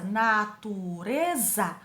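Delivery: "natureza" very slowly split into na, tu, re, za; syllable by syllable. Shown as a demonstration. Teacher counting four syllables, syllable by syllable. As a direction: say "natureza" in four drawn-out beats. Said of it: "quatro sílabas".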